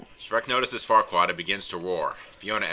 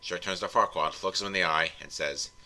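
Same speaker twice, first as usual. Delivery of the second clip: normal talking voice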